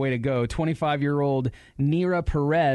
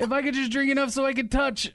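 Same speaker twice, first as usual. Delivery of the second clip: mock drinker; 10 semitones higher, faster than usual. Woman in vat voiced.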